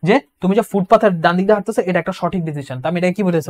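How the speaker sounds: usual speaking voice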